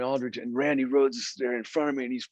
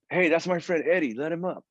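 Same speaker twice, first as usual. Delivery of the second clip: higher-pitched call to a bouncer; friendly call, lightly acted; retold calling out; 6 semitones higher than usual. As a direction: imitate calling down from the stairs. Retold call from top of stairs.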